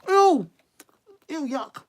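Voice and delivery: high, loud yuck at the sauce; disgusted. Real, not acted. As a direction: recoil from the taste.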